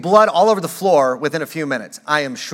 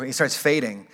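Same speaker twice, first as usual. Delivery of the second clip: ordinary delivery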